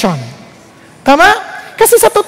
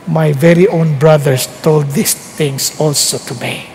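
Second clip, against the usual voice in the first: voice of God; 9 semitones lower, slower than usual. Retold or imitated. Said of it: "Anong sagot ng Diyos?".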